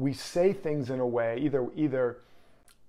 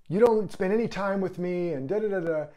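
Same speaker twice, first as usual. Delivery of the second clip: higher, louder whiny complaint; mock complaint; 6 semitones higher, 5 dB louder than usual. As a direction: mimic a nagging partner's grievance, higher and louder. Voicing a complaining partner.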